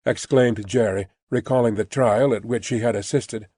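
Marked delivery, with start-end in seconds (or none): none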